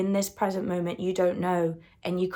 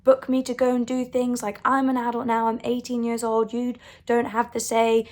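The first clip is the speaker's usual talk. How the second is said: higher-pitched indignant mock rant at mum; retold angry outburst; 5 semitones higher than usual. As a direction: replay the teenage fury at mum, pitched up. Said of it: "I got so angry".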